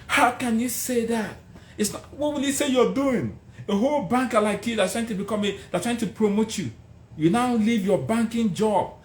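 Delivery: high incredulous scolding voices; shocked high mock of the naysayers; mocking doubters. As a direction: mimic friends telling him he's lost his mind. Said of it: "Everybody around me came after me".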